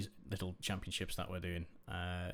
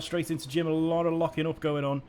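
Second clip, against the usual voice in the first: energetic play-by-play, louder; live race commentary; 6 semitones higher, 7 dB louder than usual. Acted. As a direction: call the race as it unfolds.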